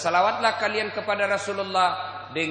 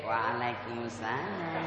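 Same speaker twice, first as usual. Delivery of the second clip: slow, soft, lilting phone reply; a girl cooing her greeting into the phone; mock swooning girl; 6 semitones lower, 9 dB quieter, much slower than usual. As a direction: melt into the answer like a lovestruck girl. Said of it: "jangan kau mendayu-dayu".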